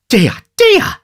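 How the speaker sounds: very high, pious wail; high, pious housewife lamenting; pious exclamation. A narrator's reading. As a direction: cry it out piously. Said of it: "ejaculated Missus Sowerby, piously".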